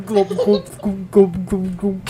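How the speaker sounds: short, gulping bubble noises; fish glubbing. Pretend. Reader joking after "fish breath", fish glubbing. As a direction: glub like a fish, playfully.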